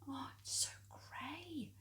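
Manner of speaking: high, breathy catty whisper. Acted. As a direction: whisper it with relish. Gossip about her hair.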